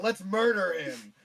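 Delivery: loud, slow gleeful yell; gleeful shout. Retold or imitated. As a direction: yell it like a battle cry. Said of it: "was like".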